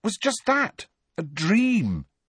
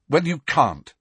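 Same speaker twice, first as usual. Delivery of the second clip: shortly; 7 semitones higher, 4 dB louder than usual. A narrator's reading. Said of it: "said Harry shortly".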